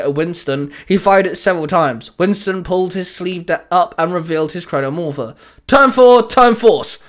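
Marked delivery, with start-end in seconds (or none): 5.7-7.0 loud, high, drawn-out battle call. Acted. Winston calls his morphing command, calling out. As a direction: proclaim it like a hero's catchphrase. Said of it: "Winston called out".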